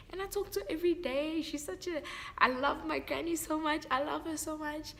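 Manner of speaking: gushing. Real, not acted. Gushing about granny.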